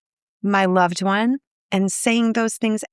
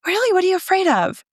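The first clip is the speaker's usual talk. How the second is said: higher-pitched, acted curious surprise; imitated surprised question; 10 semitones higher, faster than usual. Loved one answering a confession of fear, imitated surprise. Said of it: "Sometimes they say".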